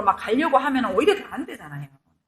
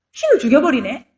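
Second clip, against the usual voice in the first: mock surprise; 7 dB louder than usual. Ironic aside about killing God.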